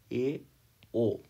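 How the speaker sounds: ordinary delivery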